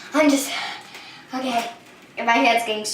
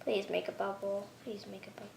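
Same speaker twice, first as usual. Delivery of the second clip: much softer, hushed plea; soft pleading; 5 semitones lower, 20 dB quieter than usual. Real, not acted. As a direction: beg the slime quietly. Pleading with slime.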